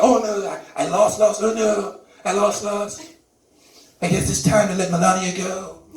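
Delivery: lightly put-on dawning dismay; Trump. President finally grasping that he lost, mild mock dismay. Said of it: "if somebody could just tell Trump".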